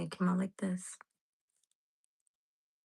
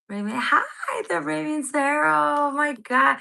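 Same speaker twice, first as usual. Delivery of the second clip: high, loud delighted welcome; excited greeting; 7 semitones higher, 8 dB louder than usual. Real, not acted. Reader spots Raven joining the chat, excited greeting. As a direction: call out to a long-missed friend, loud and high.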